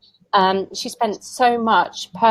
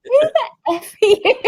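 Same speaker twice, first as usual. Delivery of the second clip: much higher, laughing disbelief; laughing inner thought; 4 dB louder than usual. Retold or imitated. Thinking about the strange visitor, laughing. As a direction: blurt it out through laughter. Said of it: "And I thought".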